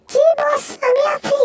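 tiny high-pitched sidekick voice; eager cartoon sidekick. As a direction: answer the boss as a squeaky little helper. Sidekick answers the boss.

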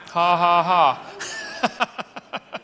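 loud, acted "ha ha ha" trailing into wheezy chuckles; hammed-up scornful laughter; mocking belly laugh. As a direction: laugh heartily at a ridiculous boast. Acts God laughing at man's boast.

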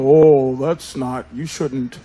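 low, slow mock-prim tut; mock disapproving men; prissy scolding man. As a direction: play a prim man wagging a finger. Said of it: "Men go".

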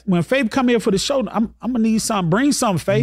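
high, excited and quick; playful excited demand. Real, not acted. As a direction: get hyped about the food. Host excitedly jokes about food.

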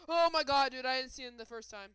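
loud, high shocked cry; startled outburst. Real, not acted. Player startled by the monster behind him, loud surprise. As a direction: yell it as the thing appears.

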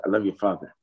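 normal talking voice

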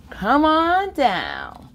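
plain reading voice

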